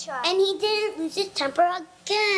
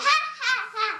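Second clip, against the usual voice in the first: mocking laughter; 6 semitones higher, much faster than usual. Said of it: "the tree laughed again".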